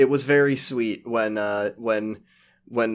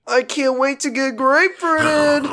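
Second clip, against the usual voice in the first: very high cartoon voice; goofy high voice; 12 semitones higher than usual. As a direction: squeak it like a cartoon.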